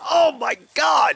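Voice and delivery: a high, loud, drawn-out "Oh my God"; imitating someone recoiling from a stench; mock shocked cry. Imagining a viewer opening the skunk jar, mock shocked cry. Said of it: "you'll be like".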